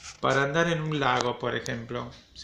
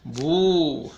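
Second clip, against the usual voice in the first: mock ghost boo; 4 semitones higher, 6 dB louder than usual. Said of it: "Como hacen los fantasmas".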